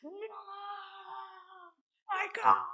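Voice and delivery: hammed-up anguished "no", held back in volume; dramatic mock wail. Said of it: "he has the most dramatic".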